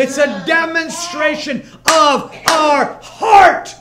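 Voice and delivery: high, slow, shouted proclamation; preaching shout. Acted. Preacher shouting the point to the church, slow and loud. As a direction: cry it out to the congregation.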